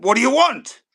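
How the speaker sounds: loud, high demand; gruff gaffer's shout. Retold or imitated. Manager to player who barged in, shouting. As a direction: bellow it at an intruder.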